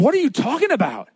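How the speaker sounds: high-pitched, disbelieving outburst; comic indignant disbelief; incredulous. Retold or imitated. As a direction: act out baffled outrage, voice leaping up. David incredulous at brothers.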